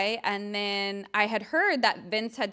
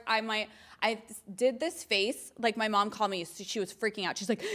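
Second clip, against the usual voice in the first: normal talking voice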